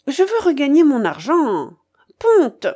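high, loud, obstinate demand; insist, then fire off the order; insistent command. Acted. Babouchka demands to win her money back, insistent.

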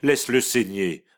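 interruption dure, plus haute; coupe sèchement. A narrator's reading. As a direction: balaie la plainte. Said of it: "interrompit madame de Ferjol". (mère qui interrompt sa fille, dure)

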